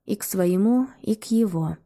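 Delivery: usual speaking voice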